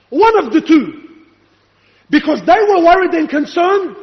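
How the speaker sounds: fervent preacher citing proof; impassioned preaching. Real, not acted. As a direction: press the evidence on the congregation. Preaches at full force.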